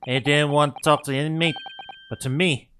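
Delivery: loose, hammed-up attempt at Scottish; botched Scottish accent. Governor grumbling about hardened criminals, botched Scottish accent. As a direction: have a rough go at a Scottish accent. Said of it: "I'm going to butcher this".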